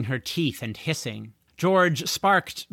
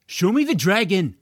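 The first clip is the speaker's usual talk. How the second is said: pushy demand; 5 semitones higher, 5 dB louder than usual. A narrator's reading. Teen punching George, demanding.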